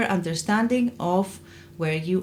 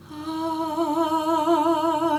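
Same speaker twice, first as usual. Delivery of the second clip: clear head voice; 9 semitones higher than usual. Shown as a demonstration. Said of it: "can become a much more clear sound".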